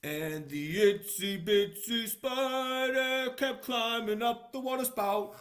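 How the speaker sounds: put-on slow, loud read-aloud for children; storybook reading. A narrator's reading. Reading the book page aloud to children, slow and loud. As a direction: read it out big and slow for little listeners.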